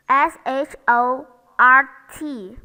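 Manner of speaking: letters S H O R T spelled out loud; spelling out. Shown as a demonstration. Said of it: "For example".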